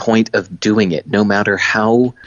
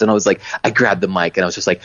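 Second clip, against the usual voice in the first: ordinary delivery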